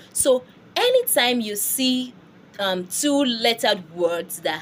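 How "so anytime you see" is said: ordinary talk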